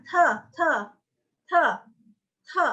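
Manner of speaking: short high "te", each T puffed with air; aspirated T. Shown as a demonstration. Teacher drilling aspirasi for students, puffed high "te". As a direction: burst air through every "te". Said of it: "Ada aspirasinya".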